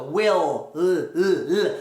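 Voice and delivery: "Will" held on its L, then isolated L sounds; tongue on the palate. Shown as a demonstration. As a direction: end "Will" on L and repeat just that L. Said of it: "botar a língua no céu da boca". (Will com a língua no céu da boca)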